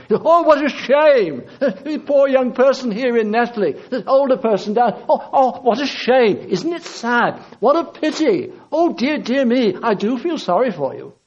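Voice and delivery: high, hammed-up sympathy with little laughs; mock pity. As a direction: tut over them in exaggerated, useless pity. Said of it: "does not just feel sorry for you".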